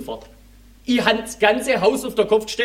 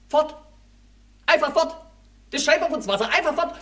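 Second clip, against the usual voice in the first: outraged disbelief; 6 semitones higher than usual. Retold or imitated. Missing washer fluid, outraged.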